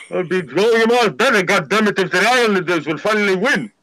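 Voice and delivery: put-on African accent, mock exasperated; African newsstand man. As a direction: imitate the newsstand guy griping. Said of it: "a guy I know, he has a newsstand".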